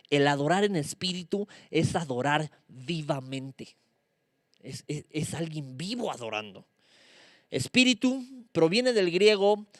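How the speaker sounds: ordinary delivery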